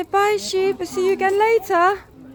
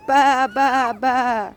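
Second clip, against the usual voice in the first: sheep bleat; 5 semitones lower, slower than usual. Shown as a demonstration. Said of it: "What do sheep do?".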